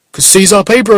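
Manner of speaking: high, loud and pushy; loud street stranger. Retold or imitated. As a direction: fire the question up high and loud. Stranger boasts.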